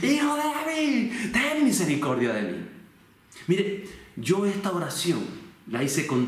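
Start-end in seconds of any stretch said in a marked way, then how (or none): 0.0-2.6 high, sustained pleading call, volume held back; half-restrained high-pitched shout; acted crying out. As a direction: call out to someone passing, begging mercy. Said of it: "comenzó a gritar".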